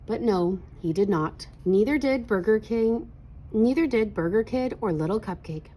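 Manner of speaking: ordinary delivery, nothing marked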